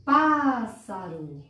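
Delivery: "Pássaro" slow, Pá stretched and strong, falling after; stressed first syllable. Shown as a demonstration. Teacher repeats for children, slowly stressing "Pá" in "Pássaro". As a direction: lean long on the "Pá" of "Pássaro". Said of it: "No pá".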